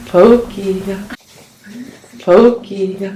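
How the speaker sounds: loud call for the pet. Real, not acted. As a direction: call the name across the house. Calling for the pet loudly.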